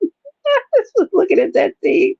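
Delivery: giggling high through the words; laughing. Real, not acted. Recalling the friend's message, laughing high. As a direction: laugh helplessly while talking.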